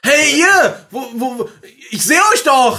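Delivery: high, very loud chasing call; shouting after runaways. Acted. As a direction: holler at escaping kids. Ruft als Verfolgerin den Kindern nach. (laut hinterhergeschrien)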